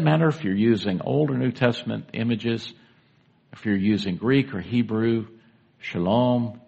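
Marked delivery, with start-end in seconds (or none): none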